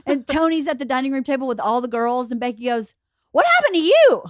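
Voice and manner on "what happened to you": high, loud alarmed friend; acted-out cry of astonishment; retold shocked question. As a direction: exclaim it in mock horror. Friend reacts to bloodied girl.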